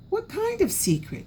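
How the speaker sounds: put-on high eager child question; curious. A narrator's reading. Little raccoon hearing of a secret, high and eager. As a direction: perk up, intrigued.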